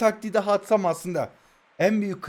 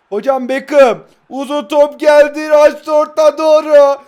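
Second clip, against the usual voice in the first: louder, higher, slower commentator voice building to the shot; excited play-by-play; 9 semitones higher, 11 dB louder, slower than usual. Acted. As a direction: call the attack like a TV commentator. Mock live commentary of his play. (yüksek sesli heyecanlı spiker anlatımı)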